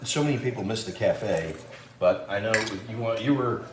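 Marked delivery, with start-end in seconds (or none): none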